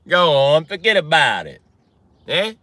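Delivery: pushy Italian-American wiseguy; mock-Italian dismissal; Italian accent. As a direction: brush them off in a loud Italian accent. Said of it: "The Italian accent".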